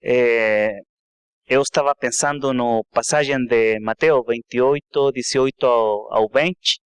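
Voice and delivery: Portuguese with a Spanish accent; non-native Portuguese, Spanish accent. Real, not acted. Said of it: "então desculpe meu português. Eu falo mel-, melhor inglês e espanhol".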